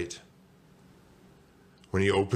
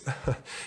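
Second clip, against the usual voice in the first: high, soft fake-sweet titter; tiny ingratiating laugh; coy little giggle; 6 semitones higher, 5 dB quieter than usual. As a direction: giggle softly to butter someone up. Sweet-voiced Arnie starts his act.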